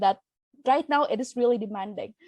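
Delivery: ordinary talk, nothing marked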